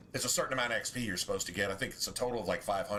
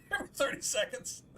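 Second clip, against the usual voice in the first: laughing; 8 semitones higher than usual. Real, not acted. Laughing at knocking Fenris down.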